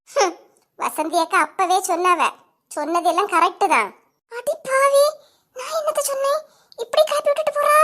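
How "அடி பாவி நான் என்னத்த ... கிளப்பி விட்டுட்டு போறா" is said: high, crying whine of the wrongly blamed; high crying protest at being blamed; wailing complaint. Acted. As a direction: wail it like she's been stabbed in the back. Falsely accused, wailing complaint.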